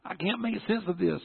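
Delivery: high-pitched anguished plea; inner desperation. Retold or imitated. Son crying out inside to God, high desperate plea. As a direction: plead in a high voice. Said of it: "inside I'm going".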